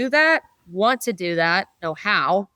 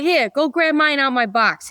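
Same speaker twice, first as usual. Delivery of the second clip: lightly voiced Brooklyn offer, a bit higher; easygoing New York accent, handing it over; 5 semitones higher than usual. Mechanic lending a tool, mild New York accent. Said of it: "your New York accent was pretty good".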